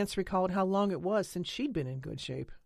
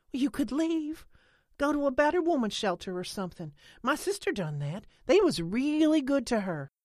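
high-pitched, eager character voice; Holly's earnest urging; 9 semitones higher, 6 dB louder than usual. A narrator's reading. Holly urging Lena to go to a shelter, eager. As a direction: urge her in a high, raised voice.

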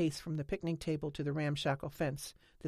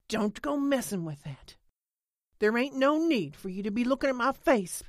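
higher, louder, defensive protest; agitated warning; 7 semitones higher, 6 dB louder than usual. A narrator's reading. Lena fending off Holly's hand, agitated. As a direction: warn her off with rising force. Lena leaps up, refuses examination.

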